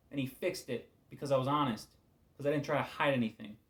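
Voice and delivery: ordinary talk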